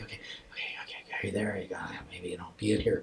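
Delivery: hushed, searching whisper; tentative whisper. Retold or imitated. Man with eyes closed calling into his dream, whispering. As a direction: whisper gingerly, as if coaxing someone back.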